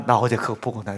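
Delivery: ordinary talk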